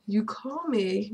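slow mock of his request; a hint of mocking the guy; mocking his line. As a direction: drag out "Can you call me?" slowly. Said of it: "I can't believe that he said".

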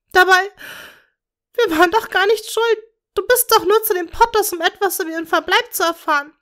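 high, loud, grief-stricken cries; anguished screaming. A narrator's reading. Bellatrix to Tom over their dead daughter, anguished scream. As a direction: scream out her pain and rage. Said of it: "schrie Bellatrix leidend".

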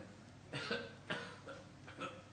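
acted throat-clearing cough; imitated attention-getting cough; pointed fake cough. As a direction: cough to be noticed from behind. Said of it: "he heard somebody coughing behind him".

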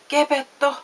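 high, loud call of a name; storybook cry for attention; urgent shout. A narrator's reading. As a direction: call out to raise the alarm. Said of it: "hän huusi".

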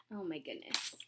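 plain reading voice

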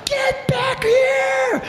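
high, stretched-out distant holler; drawn-out high-pitched angry call; lifeguard yelling. Acted. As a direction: imitate a lifeguard calling across the water.

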